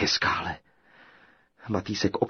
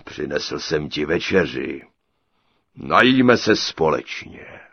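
low, ponderous giant, building louder; 6 semitones lower, 6 dB louder than usual. A narrator's reading. Giant offers supper to his captive, low ponderous voice. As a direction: speak as a slow, heavy giant.

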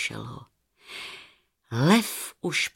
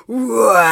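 loud, rough lion roar; big roar of a freed lion; lion's roar; 3 semitones higher, 8 dB louder than usual. A narrator's reading. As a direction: roar out in triumph. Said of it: "a zařval".

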